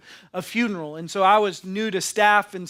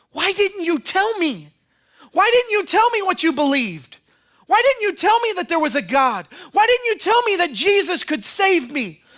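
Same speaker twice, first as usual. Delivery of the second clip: higher, louder, wailing questions; anguished accusation; 9 semitones higher, 6 dB louder than usual. Acted. Family members accusing the believer in anguish. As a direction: cry out the lost family's reproach. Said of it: "they say".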